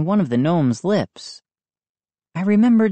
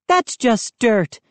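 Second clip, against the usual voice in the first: slow, impatient dismissal; mother impatient with her son; 7 semitones higher than usual. A narrator's reading. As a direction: brush it off impatiently. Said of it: "she said impatiently".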